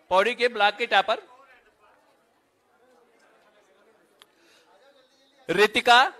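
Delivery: measured announcer voice, each name set apart; roll-call announcer. Real, not acted. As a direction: call out names at a ceremony.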